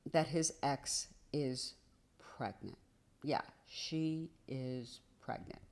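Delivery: slow, hushed, low bombshell; hushed reveal. Real, not acted. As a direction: drop your voice for the shocking news. Dramatic reveal of the pregnancy.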